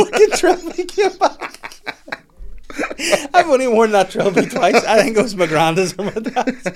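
high, loud, laughing through the words; real laughing. Real, not acted. Comic cracks up over his Trilby story, laughing hard. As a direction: barely get it out for giggles.

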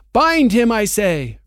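high, loud witch command. Acted. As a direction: bark the order at the hesitant hags.

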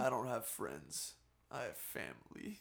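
low, hushed movie-hero quote; understated tough-guy impression; Dom. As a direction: drop it low and soft, lightly imitating. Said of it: "some classic Dom isms".

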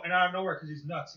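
ordinary talk, nothing marked

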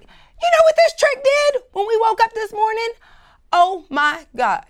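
high, loud put-on voice of the scandalized friend; friend gossiping. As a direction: dish the juicy gossip in a high shocked voice. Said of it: "She's like".

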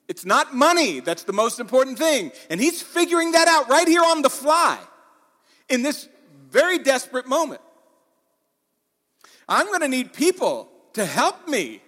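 emphatic pulpit delivery; full-force preaching. Real, not acted. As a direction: preach it loud and high to the whole room.

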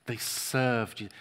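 ordinary delivery

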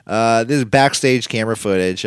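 normal talking voice

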